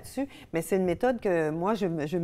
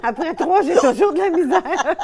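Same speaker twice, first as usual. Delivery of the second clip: words bubbling with laughter, much higher and louder; laughing while talking; 10 semitones higher, 12 dB louder than usual. Real, not acted. Laughter yoga leader laughing. (parler en riant aux éclats)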